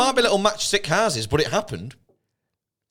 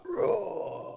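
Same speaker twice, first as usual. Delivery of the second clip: slow-mo fight grunt; 4 semitones higher, 10 dB quieter than usual. Retold or imitated. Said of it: "in slow-mo and he's like".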